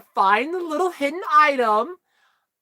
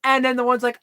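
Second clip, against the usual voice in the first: normal talking voice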